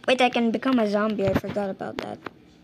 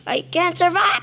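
high panicked cry; desperate panicked wail; being chased; 9 semitones higher, 4 dB louder than usual. Real, not acted. Swarmed by zombies.

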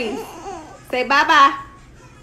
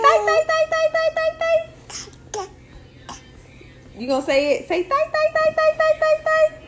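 repeated thay, thay squeaked high; high baby-talk thay. Shown as a demonstration. Mother coaxing her baby twin to say hey, high squeaky chant. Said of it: "Say hey".

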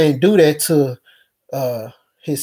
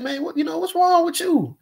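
retold angry confrontation; 11 semitones higher than usual. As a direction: replay yelling at her, voice high and indignant, volume held back. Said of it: "I got mad as hell".

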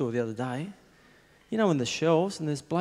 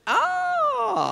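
very high, drawn-out "ah"; mock gift-reaction noise; 4 dB louder than usual. Gift receiver faking thanks for a bad present, high squealed "ah". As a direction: squeal a fake high-pitched "aah". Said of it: "they make that noise".

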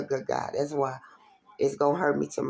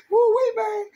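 loud high laughing whoop, real; 7 dB louder than usual. Real, not acted. Reliving the injury for viewers, a loud real whoop. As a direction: shout the remembered pain out with a laugh. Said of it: "I'm reliving that shit".

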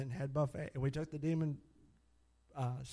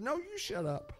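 mock comeback. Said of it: "I said".

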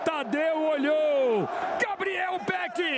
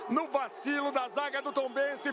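ordinary talk, nothing marked

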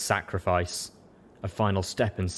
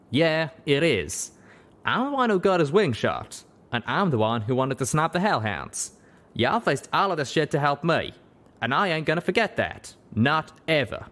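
Southern pegasus voice; 7 semitones higher than usual. A narrator's reading. Calamity's guilty vow.

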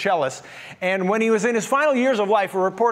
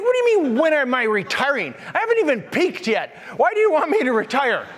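high-pitched, incredulous comeback; mock indignation; 5 semitones higher than usual. Pastor answering people who ask about retirement, indignant and incredulous. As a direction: protest it in hammed-up disbelief.

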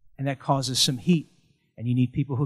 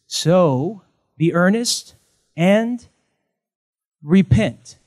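preaching; 4 semitones higher, 7 dB louder, much slower than usual. Acted. Preacher urging the church.

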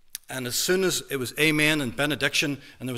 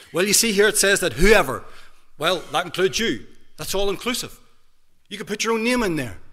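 louder, higher scripture proclamation; preaching at full force; 6 semitones higher, 7 dB louder than usual. Acted. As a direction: proclaim the verse to the hall.

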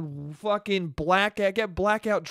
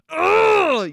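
a loud, high burst of angry ugh; frustrated grunt; 14 semitones higher, 10 dB louder than usual. Shown as a demonstration. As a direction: let the frustration explode in one loud grunt. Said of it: "I keep just being just".

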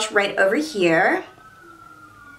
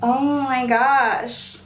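usual speaking voice

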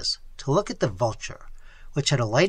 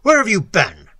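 loud, higher-pitched demand; 5 semitones higher, 9 dB louder than usual. A narrator's reading. Narrator confronts Gru from the woods, demanding loudly. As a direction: confront him sharply, voice raised. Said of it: "I demanded".

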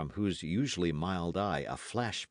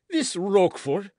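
high, raised, blustering voice; indignant cry; 10 dB louder than usual. A narrator's reading. Porthos rounds on Roquefort, indignant cry. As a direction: cry the name out with force. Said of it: "cried Porthos".

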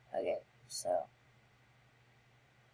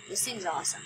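normal talking voice

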